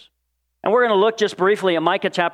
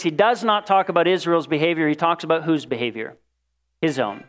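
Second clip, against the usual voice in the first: ordinary delivery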